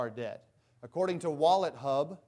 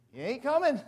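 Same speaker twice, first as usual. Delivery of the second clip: joking punchline; 10 semitones higher, 7 dB louder than usual. Pretend. Joking punchline about deer.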